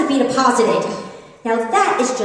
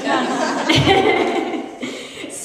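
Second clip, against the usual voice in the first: laugh; 6 semitones higher than usual. Real, not acted. Laughs after song-and-dance joke.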